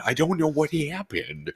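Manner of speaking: usual speaking voice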